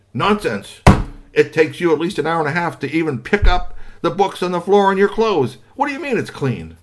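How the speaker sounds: high, loud disbelieving dad; doubtful father. Acted. Father disbelieving his son, high and loud. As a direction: scoff at the claim.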